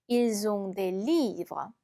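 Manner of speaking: slow, careful French model sentence; slow teacher's French read-aloud. Shown as a demonstration. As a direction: read it slowly like a language tape.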